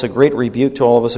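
usual speaking voice

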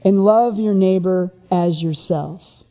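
measured scripture read, each line falling; solemn quotation of the great commandment. Acted. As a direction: read it out as holy text. Reads scripture quote.

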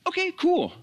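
high, breezy mock shrug; husband shrugging off an insult, mock-casual; mock casual reply. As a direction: toss it off like it's nothing, mockingly. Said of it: "that the husband would be like".

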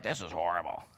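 low, soft grumbling aside; mock complainer. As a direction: grumble it under your voice. Mimics a complaining prisoner's gripe.

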